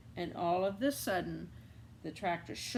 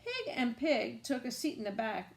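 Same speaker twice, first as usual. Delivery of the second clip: plain reading voice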